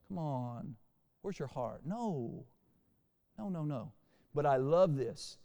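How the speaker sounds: ordinary talk, nothing marked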